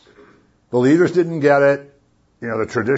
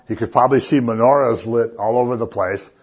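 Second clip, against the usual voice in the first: ordinary delivery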